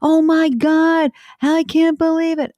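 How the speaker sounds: hammed-up astonished exclaim; amazed anchor. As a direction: play the radio anchor floored by the news. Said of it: "He said".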